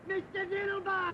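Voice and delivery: high, slow shout of a name; alarmed shout. Acted. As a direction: call out his name in alarm. Partner shouts his name in alarm.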